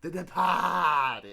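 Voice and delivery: very slow Boston "De-PAAAH-ted"; hammed-up Boston copy of "Departed"; mock Boston accent. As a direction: drag out the "ah" in "Departed", Boston style, no r. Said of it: "you're allowed to do that accent".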